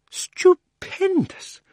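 put-on an old schemer who can't believe his luck; thrilled exclaiming. Earlier loud version.

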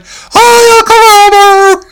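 very high, loud mock fan yell; excited fan. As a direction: squeal a greeting from the crowd. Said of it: "The one fan's like".

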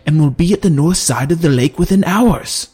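lightly voiced general warning the council; general's report. A narrator's reading. General briefing the king's advisors, urgent.

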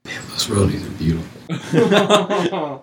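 slow, loud, laughing awe; delighted exclamation. Real, not acted. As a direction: savour every word, amazed. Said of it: "gonna be on our sound bit".

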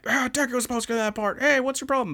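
high, mock-angry Bakugo impression; Bakugo quote. Mocking Bakugo, complaining to his classmates, high and irritated. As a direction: snap it out like a hothead. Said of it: "And he's like".

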